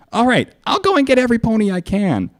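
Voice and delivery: high eager pony; Pinkie voice. A narrator's reading. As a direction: dash off cheerfully.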